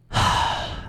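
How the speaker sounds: breathy defeated sigh; soft sigh. A narrator's reading. Violet sighs over the useless law books, soft weary sigh. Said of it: "Violet sighed".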